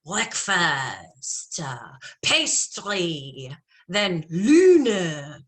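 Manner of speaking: clues in a put-on French accent, stretched slow. Game clues to partner Steven in a French accent. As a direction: drawl the clues like a Parisian. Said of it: "So I say in a French accent".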